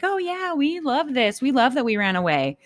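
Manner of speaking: high mock bravado, lightly sing-song; fake tough. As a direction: pretend you love it, hammed-up. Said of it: "tough and be like".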